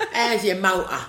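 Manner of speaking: hammed-up South London street talk; broad South East London accent. Shown as a demonstration. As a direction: exaggerate your South East London vowels. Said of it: "I'd be talking all like that".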